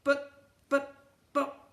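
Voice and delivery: high, loud stammering plea; beg, stumbling over the buts; pleading boy. Acted. Boy pleading with the twins, high stammering plea. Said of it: "pleaded Ned".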